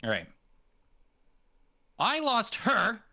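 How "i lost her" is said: HER hit hard, high and slow; punch her. Shown as a demonstration. Actor punching her for the coach, high and slow. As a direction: slam the word her like a punch. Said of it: "Punch it at me".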